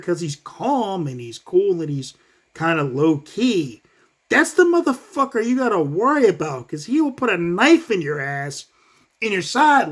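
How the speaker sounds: ordinary delivery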